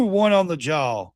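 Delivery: usual speaking voice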